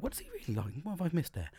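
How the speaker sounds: soft puzzled self-talk; quiet pondering. Retold or imitated. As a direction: think it under your breath. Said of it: "I was like".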